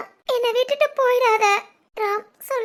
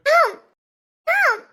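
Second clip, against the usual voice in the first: calling out; 5 semitones higher, 4 dB louder, slower than usual. Acted. Girlfriend calls after leaving boyfriend.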